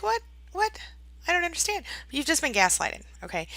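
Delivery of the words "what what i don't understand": high-pitched acted bewilderment; confused. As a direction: play someone baffled, questioning in disbelief. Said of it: "you're confused".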